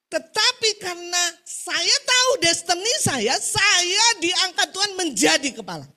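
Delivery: loud, high, slowed declaration; drawn-out, ringing preacher's delivery; preaching at full force. Real, not acted. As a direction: proclaim it loudly to the congregation.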